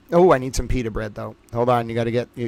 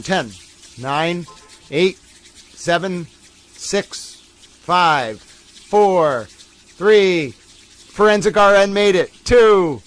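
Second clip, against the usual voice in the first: building countdown; 5 semitones higher, 6 dB louder, much slower than usual. Acted. Ten-second countdown building.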